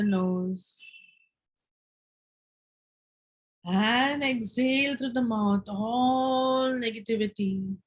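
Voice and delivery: slow, calm breathing instructions with long pauses; guided meditation. Acted. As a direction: lead them gently through each breath.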